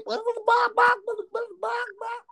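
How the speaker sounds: high, babbling mock baby noise; imitating baby gibberish. Mimicking the baby yelling gibberish at her, very high. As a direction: babble like an angry toddler.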